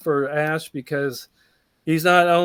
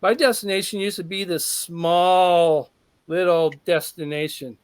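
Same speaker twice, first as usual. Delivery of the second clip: ordinary delivery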